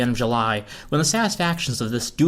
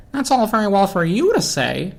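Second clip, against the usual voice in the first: retort; 7 semitones higher, 3 dB louder than usual. Acted. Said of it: "the tensor lamp retorted".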